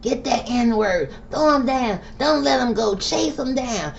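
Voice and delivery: high, slow mock officer barking orders; lightly voiced mock police command; imitated police command. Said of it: "he probably was giving the command".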